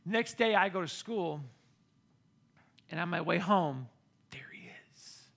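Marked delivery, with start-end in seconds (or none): 4.3-5.3 hushed whisper. Retold or imitated. Stalker spotted again.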